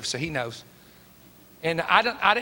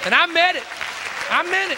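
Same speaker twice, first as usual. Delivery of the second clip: emphatic declaration; 8 semitones higher, 4 dB louder than usual. Real, not acted. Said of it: "emphatic preacher repeat".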